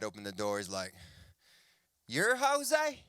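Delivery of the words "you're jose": high-pitched disbelief, acted out; incredulous dad. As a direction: play a dad who can't believe it. Girlfriend's dad reacts in disbelief.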